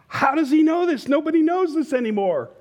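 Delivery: can't believe his ears; amazed bartender. Acted. Bartender amazed inner thought.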